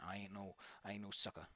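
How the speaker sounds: low, soft grumbling king voice; lightly voiced low grouchy king; grumpy mad king. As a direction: mutter like a sulky tough king. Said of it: "He's like".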